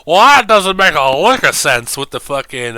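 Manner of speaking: mock Madden complaint; Madden impression. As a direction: grumble it like an old football announcer. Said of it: "bad Madden impressions".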